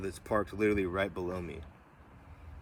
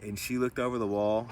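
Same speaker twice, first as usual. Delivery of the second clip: ordinary delivery, nothing marked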